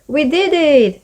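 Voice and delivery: bright, happy cheer; thrilled they pulled it off. A narrator's reading. Rachel to Maddie, a little happy cheer. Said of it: "she said happily".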